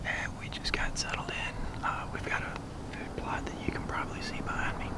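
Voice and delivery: hushed hunting whisper. Real, not acted. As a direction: whisper so the deer won't hear. Hunter whispering in the blind.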